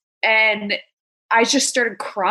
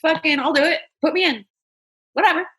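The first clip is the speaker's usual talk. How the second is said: bold bravado; 8 semitones higher, faster than usual. Retold or imitated. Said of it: "I was like".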